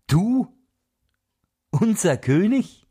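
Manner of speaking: high, indignant outcry of the birds; angry birds. Acted. The birds answer the wren with mild, high indignation. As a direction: scoff at the upstart, mildly outraged. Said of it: "schrien die Vögel zornig".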